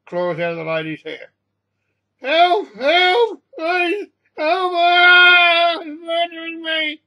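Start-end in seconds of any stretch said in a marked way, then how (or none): 2.2-7.0 high, loud screams for help; panicked screaming. A narrator's reading. Woman attacked by a cat screams for help, panicked screaming. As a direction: scream as if being murdered. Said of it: "This woman is screaming".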